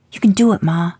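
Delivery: put-on high, loud boyish encouragement; cheering kid. A narrator's reading. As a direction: pump up his mom like a proud son. Son cheering mother on.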